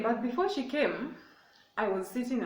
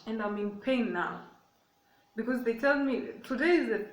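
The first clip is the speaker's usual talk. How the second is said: ordinary delivery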